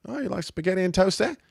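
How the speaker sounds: high mock Kiwi accent; New Zealand accent. Host offering breakfast to guests, in a high New Zealand accent. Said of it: "Gonna speak with a New Zealand accent".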